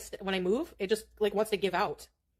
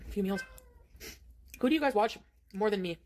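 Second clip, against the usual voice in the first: ordinary talk, nothing marked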